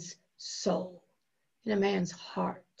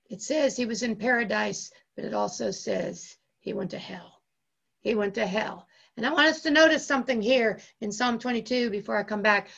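usual speaking voice

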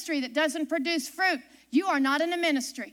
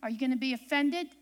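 usual speaking voice

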